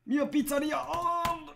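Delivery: high, loud excited cry; excited shout. Real, not acted. Exclaims in game. (lauter, aufgeregter Ruf)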